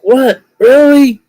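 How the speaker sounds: shocked guy can't believe it; high-pitched double take of amazement; 1 stunned surprise. Retold or imitated. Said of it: "He's like".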